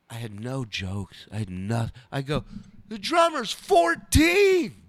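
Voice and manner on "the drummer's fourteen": slow, high-pitched shouted punchline; desperate punchline. Retold or imitated. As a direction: shout it as if it were a huge joke. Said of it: "Like, that was a punchline".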